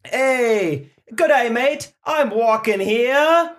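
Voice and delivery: put-on loud, high mashup of Australian and Boston accents. Milkman mixing Australian and Boston accents in a skit. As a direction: bark it like an Aussie New Yorker. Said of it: "combining Boston".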